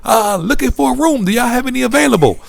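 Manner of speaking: put-on Canadian accent. Clerk phoning another hotel, high Canadian accent. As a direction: rattle it off like the Canadian clerk on the phone. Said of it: "I can't make the accent".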